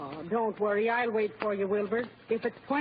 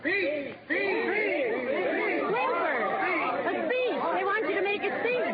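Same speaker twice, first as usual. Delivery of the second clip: calling out over a crowd; 7 semitones higher, slower than usual. Acted. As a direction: shout to be heard over the room. Wife calling to husband over crowd.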